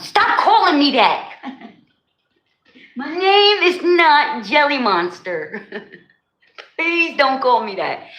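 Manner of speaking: high-pitched mock indignation with laughter; laughing, exaggerated complaint; playful protest. Real, not acted. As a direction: object in mock outrage, breaking into laughs.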